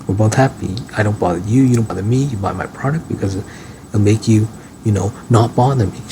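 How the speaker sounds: ordinary talk, nothing marked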